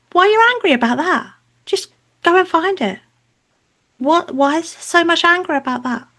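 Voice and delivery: high-pitched pointed questioning; acted exasperation. Mother answering her angry relative, exasperated challenge. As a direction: act out challenging them, pitch kept well up.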